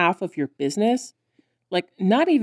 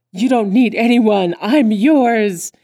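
loud, higher, hammed-up suitor; melodramatic declaration; 4 semitones higher, 6 dB louder, slower than usual. Riot grabs her and insists, melodramatic declaration. Acting Riot's possessive declaration.

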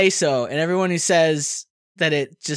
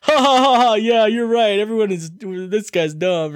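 mock crowd laughter; 3 semitones higher than usual. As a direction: imitate an easily amused crowd. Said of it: "And then everyone's like".

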